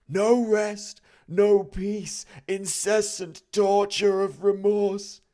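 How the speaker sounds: high, loud, slow mournful spirit voice; wailing ghost. A narrator's reading. The ghost lamenting its fate to Scrooge, high and wailing. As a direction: moan it like a doomed soul.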